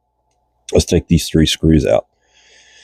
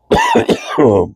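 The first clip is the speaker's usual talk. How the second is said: a hacking cough, congested chest; real chesty cough. Real, not acted. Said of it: "Excuse me".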